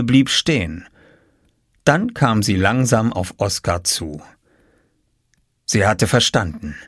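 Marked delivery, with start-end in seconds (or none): none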